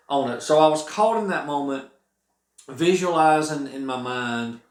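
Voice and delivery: ordinary delivery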